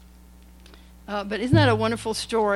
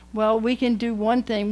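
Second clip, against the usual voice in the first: normal talking voice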